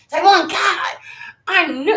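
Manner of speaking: high, loud burst of dismay at herself; Taiwan, of course, in real exasperation; high, loud exasperated cry; surprised groan. Real, not acted. Realizes she guessed wrong.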